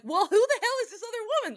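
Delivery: high, fast, incredulous outburst; who the hell, squeaked high and quick; exasperated question. Retold or imitated. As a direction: ask it with shocked disbelief, high up. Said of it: "And I'm like".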